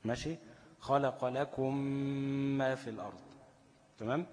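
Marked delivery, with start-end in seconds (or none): 0.8-3.2 "خلق لكم" بإدغام الميم وغنة بصوت أعلى; شدّ ميم "لكم ما" وغنّها حركتين; merged mim with ghunna. Shown as a demonstration. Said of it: "هتطبق شفتين وتتكة جامد كمان". (ميم مشددة مغنونة في "لكم ما")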